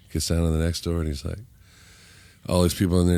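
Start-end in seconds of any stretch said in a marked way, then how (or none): none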